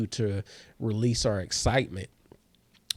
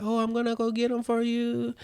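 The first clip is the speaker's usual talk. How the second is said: high, sing-song waiter voice; waiter impression; 12 semitones higher than usual.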